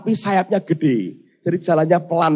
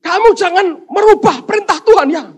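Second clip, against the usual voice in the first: high, loud angry warning; furious ranting; 10 dB louder than usual. Acted. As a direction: rage at the messenger. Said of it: "Ngamuk orang Kristennya".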